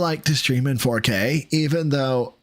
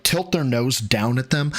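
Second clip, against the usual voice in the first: ordinary talk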